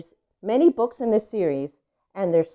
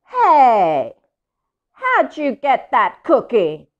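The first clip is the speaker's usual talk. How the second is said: louder, slow, demanding pigeon; grumpy pigeon; 6 semitones higher, 8 dB louder, slower than usual. Acted. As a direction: confront the duckling over the cookie. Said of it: "Pigeon's not a happy bird".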